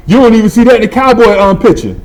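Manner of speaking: high, loud, quick delivery; performed catchphrase. Acted. Acting out an old family saying, high and loud. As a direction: belt out the saying high and fast.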